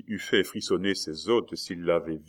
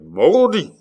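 loud, much higher furious cry; the swordsman losing his temper at the innkeeper; exasperated oath; 11 semitones higher, 10 dB louder than usual. A narrator's reading. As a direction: explode with exasperation. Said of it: "s'écria Coconas, exaspéré".

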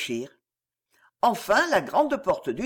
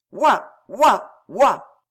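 dog barking; 3 semitones higher, 7 dB louder, much slower than usual. A narrator's reading. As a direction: bark "woof" like a dog. Barks punctuate the minister's speech.

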